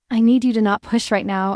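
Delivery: usual speaking voice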